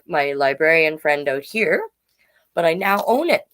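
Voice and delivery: plain reading voice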